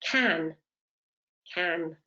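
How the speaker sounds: CAN with short "a", said alone; British can. Shown as a demonstration. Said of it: "pronunciation of my vowel changes".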